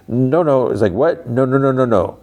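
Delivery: acted alarm, trying to stop someone getting in; lightly imitated fluster; mild mock panic. Retold Mark stopping her getting in.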